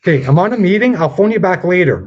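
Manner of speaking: high, loud forced-polite phone voice; 1 strained phone voice. Real, not acted. To the caller, a loud high forced-polite brush-off. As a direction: pitch it way up and bright to cut the caller off.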